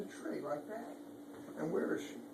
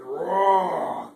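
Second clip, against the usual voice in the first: loud roaring tiger; booming playful tiger roar; 2 semitones higher, 11 dB louder, much slower than usual. Acted. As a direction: roar it out big for a child. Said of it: "But the tiger said".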